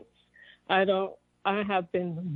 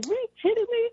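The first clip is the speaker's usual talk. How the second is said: disbelief; faster than usual. Retold or imitated. Retelling her own disbelief in bed.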